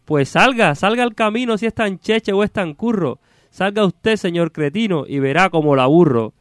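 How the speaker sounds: high-pitched, declaimed cursing in verse; theatrical exasperated ox-driver; irritated carter. Acted. Stuck carter cursing whoever called him, exasperated.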